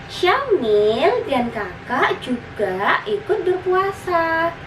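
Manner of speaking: high, lilting big-sister voice; explaining big sister. Acted. Older sister explains Ramadan fasting to her little brother, high explaining voice. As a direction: explain it sweetly, stretching the key words. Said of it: "jelas sang kakak".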